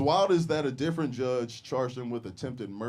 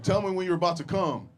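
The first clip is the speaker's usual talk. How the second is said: higher, louder breathless lover; mock Sandra Bullock; 7 semitones higher, 6 dB louder than usual. Sandy in bed with comedian, loud mock passion. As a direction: pitch it up, teasing and loud.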